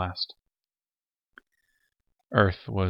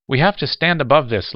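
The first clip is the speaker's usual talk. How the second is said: higher, louder plea; protesting; 6 semitones higher, 8 dB louder than usual. A narrator's reading. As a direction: push back hard against the hate. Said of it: "Lamar protested".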